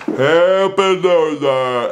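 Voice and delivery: very slow, drawn-out "Happy New Year"; tipsy toast; drunken greeting. Retold or imitated. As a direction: slur it out like a dazed host. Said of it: "like me".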